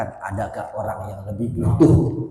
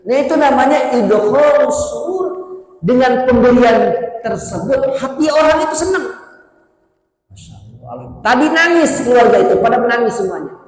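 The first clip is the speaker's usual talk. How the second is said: higher, louder fiery sermon voice; full-force preaching; 12 semitones higher, 9 dB louder than usual. Acted. Preacher rouses the congregation about helping others, full force. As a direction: proclaim it like a rousing preacher.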